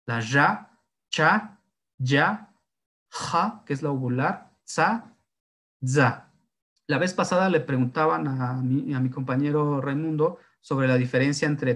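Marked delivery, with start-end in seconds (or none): 0.1-6.3 cada sílaba clara y separada; fonemas pronunciados. Shown as a demonstration. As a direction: demostrar cada sonido de la lengua. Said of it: "En estas". (pronunciar los fonemas uno por uno)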